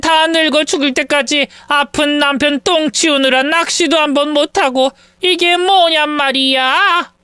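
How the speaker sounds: hammed-up weeping grievance; wailing lament. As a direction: bemoan your whole life in self-pity. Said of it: "눈물을 쏟아내시며 저희 앞에서 한탄을 해대셨습니다".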